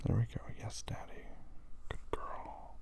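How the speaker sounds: hushed praise, barely voiced; whispering. Praising in a hush.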